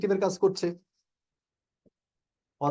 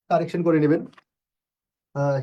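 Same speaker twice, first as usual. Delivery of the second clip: ordinary delivery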